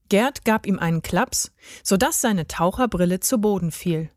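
ordinary delivery, nothing marked

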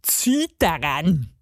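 low, slow dwarf order; put-on gnomish instruction; commanding garden gnome. A narrator's reading.

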